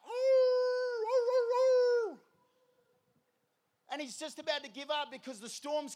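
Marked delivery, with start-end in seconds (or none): 0.1-2.2 loud, high, sustained wolf howl. Acted. Wolves coming out around the shepherd, howling. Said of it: "the wolves are coming out".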